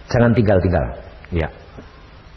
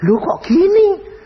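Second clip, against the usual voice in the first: crying father; 5 dB louder, slower than usual. Retold or imitated. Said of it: "Papa nangis".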